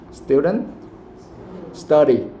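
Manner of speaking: normal talking voice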